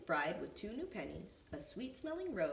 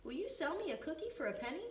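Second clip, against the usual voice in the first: put-on high little-boy voice; young Benny; 10 semitones higher, faster than usual. A narrator's reading. Benny asks Lucy May for a cookie, high and polite. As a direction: ask politely like a small boy. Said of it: "asked Benny".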